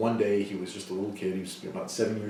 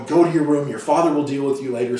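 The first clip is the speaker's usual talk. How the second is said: high, loud mock scolding; imitated motherly shouting, easing slightly at the end; screaming mom; 6 semitones higher, 9 dB louder than usual. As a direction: yell it like a panicked mother banishing her son. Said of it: "she screams at the boy".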